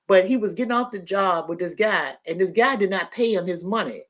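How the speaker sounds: ordinary talk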